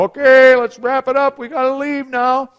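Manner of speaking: loud, high announcement to clear out; imitated guard's call. Guards ending the jail chapel visit, imitated loud call. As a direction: call time over the whole room. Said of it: "The guards go".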